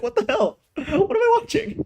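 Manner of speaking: high, disbelieving squeal of what the hell; incredulous. Real, not acted. Reviewer reacting to the anime plot, incredulous high squeal. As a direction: pitch shooting up in baffled disbelief.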